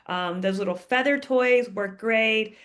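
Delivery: normal talking voice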